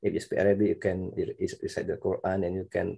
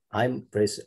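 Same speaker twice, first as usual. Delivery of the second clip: ordinary talk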